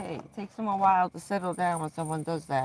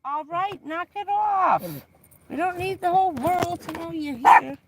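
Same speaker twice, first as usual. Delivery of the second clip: high, louder telling-off; loud scolding; 9 semitones higher, 6 dB louder than usual. Real, not acted. Owner hushing the bird so nobody notices, loud scold. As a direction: shut him up before the neighbours hear.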